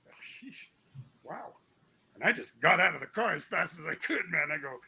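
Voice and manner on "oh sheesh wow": breathy whispered shock; barely voiced disbelief; stunned whisper. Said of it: "And I'm like".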